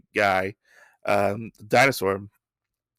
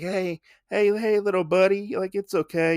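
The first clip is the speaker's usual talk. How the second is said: soothing a scared creature; 8 semitones higher than usual. Retold or imitated. Usagi soothing scared little Tokage.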